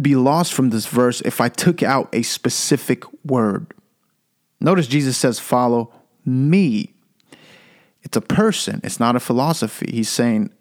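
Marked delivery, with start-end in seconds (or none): 5.4-6.9 slow, with a pause before "me"; stress on "me". Shown as a demonstration. Preacher meditating on Jesus' words, stress on "me". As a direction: set "me" apart and stretch it. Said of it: "So I'm emphasizing each word".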